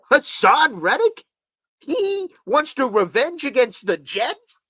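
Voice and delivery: slow, incredulous rising questions; stunned, slow why-would-he question. Real, not acted. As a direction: drag it out in disbelief.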